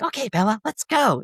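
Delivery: high, chirpy director voice; upbeat high Ed Wood impression; quoting Ed Wood. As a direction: cheerfully hurry the actor along. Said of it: "Ed Wood was like".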